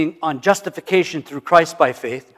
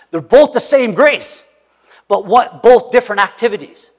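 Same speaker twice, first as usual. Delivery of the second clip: emphatic; 5 semitones higher, 6 dB louder than usual. Real, not acted. Emphatic point, louder.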